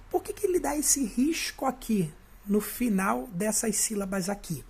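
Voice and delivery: usual speaking voice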